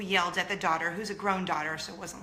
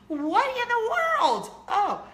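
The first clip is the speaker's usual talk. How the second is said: much higher, slow outraged disbelief; incredulous; 14 semitones higher, 5 dB louder, slower than usual. Real, not acted. Venting to viewers, slow high disbelief. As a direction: stretch out the disbelief.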